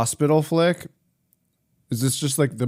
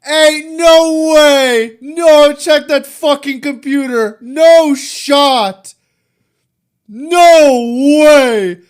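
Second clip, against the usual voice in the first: loud, high disbelieving yells; shocked shouting; 13 semitones higher, 12 dB louder, much slower than usual. Real, not acted. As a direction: scream in disbelief at the play. Reacting to an impossible clutch.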